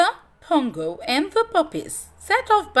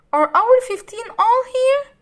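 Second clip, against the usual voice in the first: high, anxious question; 13 semitones higher, 6 dB louder than usual. A narrator's reading. Perdita anxiously asks the captain about her pups. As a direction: ask in a worried rush. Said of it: "asked Perdita anxiously".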